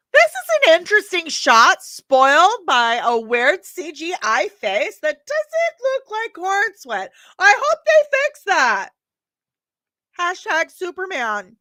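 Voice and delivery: high-pitched, loud caricature of a movie critic; Grace Randolph. Acted. Vlogger mimicking a critic's verdict on a Superman teaser, mocking impression. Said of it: "my best Grace Randolph voice".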